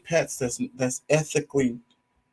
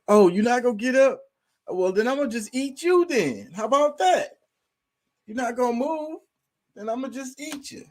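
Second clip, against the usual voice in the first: higher, pushy mock cat; cat's voice; 10 semitones higher than usual. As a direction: voice a bossy, hungry cat nagging its dead owner. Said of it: "they'll be like".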